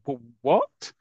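a quick startled rise; mock surprise. Johnny hearing of the big offer, mild mock surprise. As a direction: play it lightly, caught off guard. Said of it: "And Johnny's gone".

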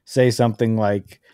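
ordinary talk, nothing marked

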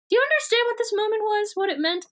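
high-pitched, hurried fervour; mock impassioned eyewitness. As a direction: press the listener with theatrical urgency. Acts impassioned eyewitness recalling history.

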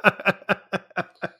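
a bright burst of laughter; hearty laugh. Real, not acted. Laughing with the co-host about a sweet ending.

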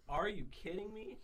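slow, high, stunned question; quoting shocked parents; shocked disbelief. Retold or imitated. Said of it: "they were like".

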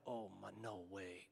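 very low, hushed inner voice; soft, helpless murmur to yourself; overwhelmed disbelief. Retold or imitated. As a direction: barely say it, as tears threaten. Retold feeling of tears welling up.

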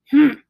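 one forceful snort through the nose. A narrator's reading. Uncle hearing the children's apology, snorting loudly. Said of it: "he snorted for a third time".

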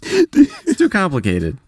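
slow, high, laughing words; giggling it out slowly. Real, not acted. Still laughing.

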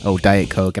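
usual speaking voice

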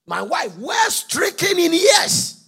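high, loud, slow protesting shout; Zachariah's shout. Acted. Preacher acting Zachariah arguing with the angel, loud slow shout. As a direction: bellow that the wife is old. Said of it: "He said".

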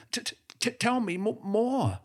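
high stammering squeak; flabbergasted businessman stuttering in a high voice. Acted. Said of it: "he was still able to stutter".

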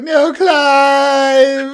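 long, loud high wail; Sinister. Retold or imitated. Sinister seeing Clive crash, drawn-out mock scream. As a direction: howl it out in hammed-up anguish.

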